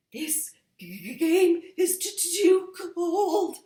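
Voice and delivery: stammered, teeth-chattering cold voice; shivering stutter. A narrator's reading. Cold character stammering about the game, shivering. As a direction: stutter as if frozen stiff. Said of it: "said somebody".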